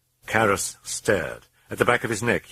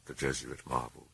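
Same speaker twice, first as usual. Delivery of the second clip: plain reading voice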